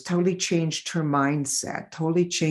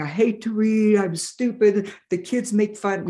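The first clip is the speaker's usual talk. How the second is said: higher, whiny imitation of a tearful child; mock crying child; 5 semitones higher than usual. Seven-year-old patient asked about reading, imitated as crying. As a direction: imitate a little kid crying, understated. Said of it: "started crying".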